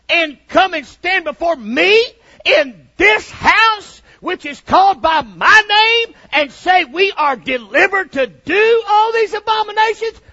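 loud, high, shouted reading of God's words; thundering scripture. Acted. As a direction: proclaim it like God's own rebuke. Quoting God at full force.

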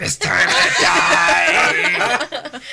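loud, very slow demon roar; demonic voice. Pretend. Host joking as an adult at a till, loud demonic voice. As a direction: drag out a hammed-up demonic shout. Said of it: "speak in a demonic voice".

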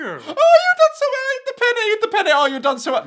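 very high, loud squealing praise; hammed-up hysterical pet owner; manic gushing. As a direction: shriek it in doting excitement. [name] gushing over bird.

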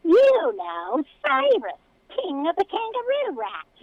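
pompous whine, each word careful; whiny voice. Acted. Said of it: "the whiny voice continued, speaking each word carefully".

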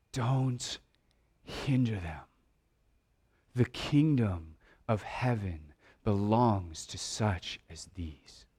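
low, very soft and slow, near a whisper; a still small voice, hushed and spaced out with pauses; whispering inner voice. Acted. Still small voice speaks in the listener's head, whispering. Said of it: "still small voice in the back of your head whispering".

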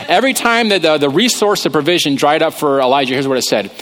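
ordinary talk, nothing marked

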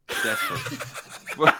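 Desperate said through a long high laugh. Real, not acted. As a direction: laugh the word Desperate out, stretched slowly. Laughing at his own joke.

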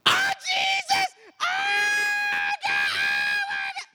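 imitated full-force scream, very high and drawn out. Preacher imitates a girl screaming in church, loud drawn-out scream. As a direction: scream it out at the top of your voice, imitating her. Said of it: "she started screaming like".

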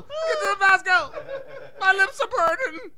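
high, loud hammed-up cry for hot sauce; mock pained shout. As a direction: ham up burning lips, yelling. Mock burning lips.

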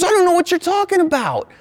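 hammed-up high-pitched angry mom; outraged mom. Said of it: "She goes".

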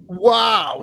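very high mock-impressed exclamation; sarcastic amazement. Fan mocking a rival quarterback's four wins, sarcastic amazement. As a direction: feign being hugely impressed.

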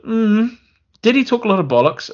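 high, sceptical musing; mock pondering. Reviewer questioning the film's hero, mock pondering. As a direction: wonder aloud in a high, sarcastic voice.

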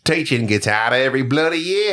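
broad put-on Aussie accent; hammed-up Australian reading. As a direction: lean into a mock Australian "bloody year". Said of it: "I gotta do it".

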